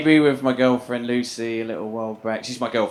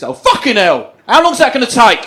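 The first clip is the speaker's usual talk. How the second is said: loud, higher, exasperated complaint; loud impatient outburst; 8 semitones higher, 10 dB louder than usual. Retold or imitated. Man breaking a minute's silence, loud exasperated outburst. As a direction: blurt it out loudly, fed up.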